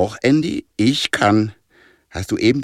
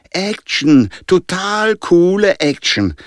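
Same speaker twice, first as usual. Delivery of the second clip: high, drawn-out thrill, louder on the first word; excited cheer; 9 semitones higher, 4 dB louder, slower than usual. A narrator's reading. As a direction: relish the adventure, slow and high. Boy cheers on a risky adventure.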